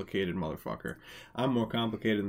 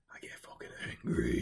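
whisper; 6 dB quieter than usual. Pretend. Whispered angry line.

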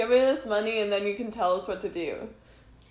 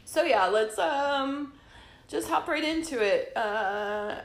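ordinary talk

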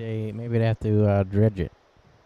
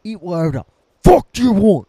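loud high yell; shouting; 5 semitones higher, 8 dB louder than usual. Acted. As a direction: belt it out. Echoing shouted line.

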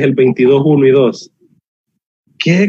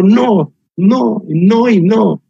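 each no slow and deliberate; slow emphatic no; 6 semitones higher, much slower than usual. Real, not acted. Pastor to the young people, slow insistent no. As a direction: drag out every no. Preacher hammering repeated no.

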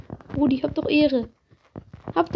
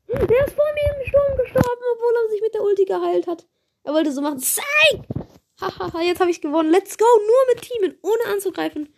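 high-pitched gloating after winning; gleeful victory; 8 semitones higher than usual. Real, not acted. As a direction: crow over a sneaky win.

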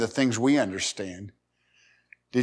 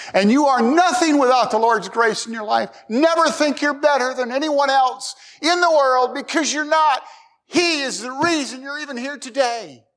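preaching at full force; 12 semitones higher than usual. Real, not acted. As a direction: lift it up and proclaim it to the church. Preaching climax on grace.

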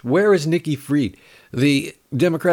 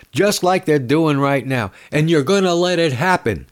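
usual speaking voice

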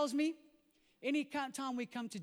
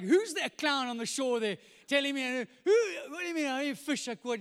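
loud, high indignant grumbling; frustrated; 6 semitones higher, 10 dB louder than usual. Acted. Peter grumbles about the stranger on shore, frustrated. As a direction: sputter with mock indignation. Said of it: "he's frustrated".

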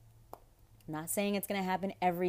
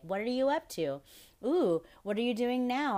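lightly mocking, higher-pitched prying voice; fake nosy people; 6 semitones higher, 3 dB louder than usual. Mimicking fake people who just want gossip, lightly mock-curious. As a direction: imitate a fake friend fishing for gossip, pitched up. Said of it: "fake people out there who".

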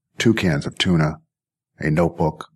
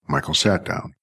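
plain reading voice

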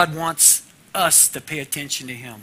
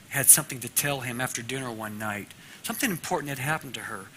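usual speaking voice

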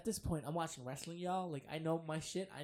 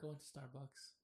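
ordinary talk, nothing marked

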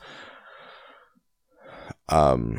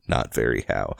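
low, soft gloomy donkey voice; Eeyore; 9 semitones lower, 5 dB quieter than usual. Acted. Reader repeats Eeyore's line to herself, gloomy and low. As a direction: drop the voice into gentle gloom.

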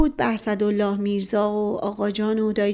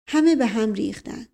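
usual speaking voice

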